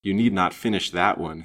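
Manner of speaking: ordinary delivery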